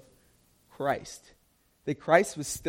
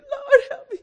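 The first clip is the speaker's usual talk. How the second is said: high, loud, half-laughing cry; hammed-up panic, calling for help; panicked plea; 7 dB louder than usual. Believer crying to the Lord, high and loud. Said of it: "you're like".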